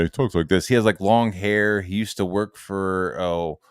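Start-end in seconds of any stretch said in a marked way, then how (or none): none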